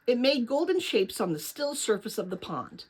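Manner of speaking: usual speaking voice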